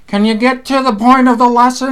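lightly hammed-up demanding commenter; impatient viewer. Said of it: "there are people who be like".

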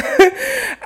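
a sudden squeaky high laugh; teary high laugh. Real, not acted. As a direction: laugh through the tears, high and short. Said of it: "I'm getting emotional".

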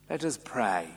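ordinary talk, nothing marked

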